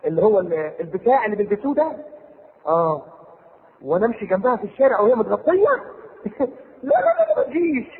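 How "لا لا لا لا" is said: laughing high no, no. Real, not acted. Preacher laughing at the husband's reaction, laughing. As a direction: laugh through the words.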